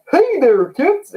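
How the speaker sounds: high, sing-song clown greeting; mock cheery clown voice rising high; Ronald McDonald clown. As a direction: chirp it like a fast-food clown mascot. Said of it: "like a Ronald McDonald clown".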